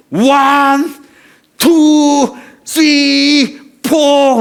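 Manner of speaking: loud, high, slow boxing count; referee's count. Retold or imitated. Preacher acts the referee counting over both fallen boxers, loud ring count.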